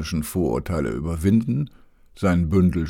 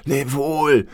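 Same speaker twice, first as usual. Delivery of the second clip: higher, louder farewell call; 8 semitones higher, 5 dB louder than usual. A narrator's reading. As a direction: call the goodbye across the deck. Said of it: "rief er noch". (laut rufend zum Abschied)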